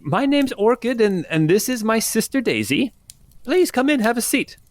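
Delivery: put-on high, sweet old woman; welcoming old innkeeper lady. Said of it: "you only have one old lady voice".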